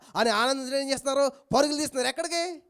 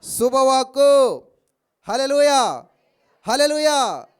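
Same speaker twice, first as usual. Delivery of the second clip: slow, loud, drawn-out call; proclaiming; 3 semitones higher, 10 dB louder, much slower than usual. Real, not acted. Preacher proclaiming the good word to the church. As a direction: ring it out to the church.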